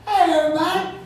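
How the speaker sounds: retry the hello, big and bright in Mississippi twang; loud Mississippi greeting. Acted. A Mississippi poet restarts his hello to the crowd, high loud twang. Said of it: "If y'all can tell from my accent".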